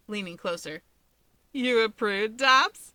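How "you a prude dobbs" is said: teasing jab, rising louder; smirking tease. A narrator's reading. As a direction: lean in and needle him. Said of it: "The bird smirks at him".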